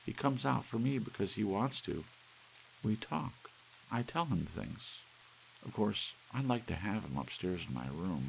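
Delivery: high and lightly breathless, fond confession; talking of the cuckoo like a friend; Doris dreamy. Acted.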